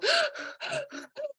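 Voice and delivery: sharp high gasp of breath; quick gasp. Shown as a demonstration. Showing listeners how stress speeds breathing, a sharp gasp. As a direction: show a stressed intake of breath. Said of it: "our breath can speed up".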